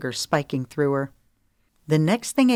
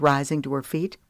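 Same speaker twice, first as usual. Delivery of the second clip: ordinary delivery, nothing marked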